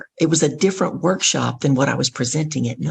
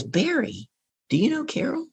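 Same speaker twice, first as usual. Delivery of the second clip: high, softer retold question; lightly astonished female-colleague imitation; surprised question; 12 semitones higher, 5 dB quieter than usual. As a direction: imitate her puzzled asking, pitched up. Imitates surprised woman asking.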